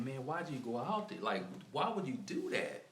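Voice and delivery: high, baffled pleading; retold question to the dead man; exasperated. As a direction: ask him why, in disbelief. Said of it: "I'm like".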